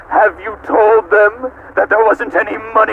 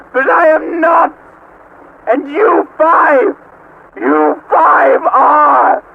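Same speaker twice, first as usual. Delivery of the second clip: ranting shout; 7 semitones higher than usual. Acted. AI god ranting at the five humans, shouting. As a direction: declaim it like a furious god.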